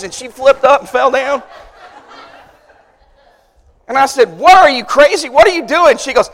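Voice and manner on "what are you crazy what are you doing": retold indignant yell, higher and louder; indignant outburst. Big brother to little sister after being hit, indignant. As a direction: act out exasperated disbelief, pitched up.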